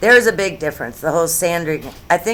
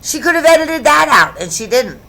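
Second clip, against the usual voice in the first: loud, high, emphatic yell; yelling like Oprah; 10 semitones higher, 7 dB louder than usual. Real, not acted. Vlogger criticizing an unedited line, yelling like Oprah. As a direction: raise it to a yell, easing off at the end. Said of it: "there I'm yelling like Oprah does".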